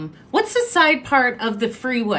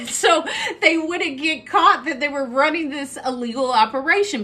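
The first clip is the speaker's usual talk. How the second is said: laughing; 5 semitones higher than usual. Real, not acted. Laughing while telling story.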